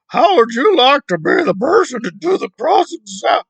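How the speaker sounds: high, loud mock of an indignant questioner; hammed-up, squealing replay of his question; mocking imitation. Commentator mimicking the man's cross-examination question, mockingly. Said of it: "And then we get to".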